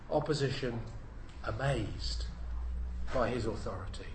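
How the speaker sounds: low, soft echo of the heading; quiet repeat. Shown as a demonstration. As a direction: let the heading sink in softly.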